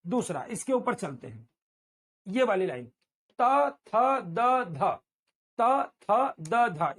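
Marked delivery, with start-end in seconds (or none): 3.4-6.9 त थ द ध said twice, tongue on the teeth, loud and crisp; model tooth sounds. Shown as a demonstration. Teacher introduces the tooth row: त थ द ध, crisp. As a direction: drill त, थ, द, ध with a sharp tap on the teeth. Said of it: "इसके बारे में सीखिए".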